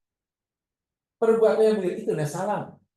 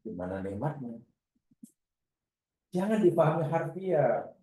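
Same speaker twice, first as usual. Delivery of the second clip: ordinary talk, nothing marked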